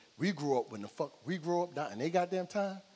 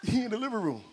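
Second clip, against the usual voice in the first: higher-pitched imitation of a crying kid; mock crying; 7 semitones higher, 3 dB louder than usual. Crying nephew telling his uncle where the bully is, mock tearful. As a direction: lightly mimic a tearful nephew. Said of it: "And he crying still".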